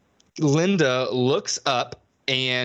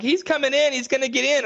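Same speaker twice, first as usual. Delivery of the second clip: high hammed-up fear; mock panic; 10 semitones higher than usual. Mocking the girls at the door, high mock fear. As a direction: whine it like a scared horror girl. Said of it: "And they're like".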